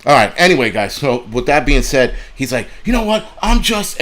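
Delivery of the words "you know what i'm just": much higher, worked-up imitation; high-pitched mock scream. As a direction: imitate an angry man's voice shooting up high. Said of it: "his voice went high-pitched".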